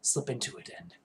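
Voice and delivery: low, hushed, harsh voice; subdued version of a dark hero voice; Vald. Shown as a demonstration. Player slipping into his current character, quiet and subdued. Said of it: "do this voice without much trouble".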